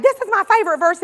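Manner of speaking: quick, eager gush; excited. Retold or imitated. Pastor retelling her Bible study class, mildly excited and quick. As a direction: rush it out like a thrilled teacher. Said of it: "I'd get all excited".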